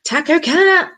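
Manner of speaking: high, loud "Taco Cat!"; playful exclaim. Real, not acted. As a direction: shout it like a punchline. Shouting a palindrome.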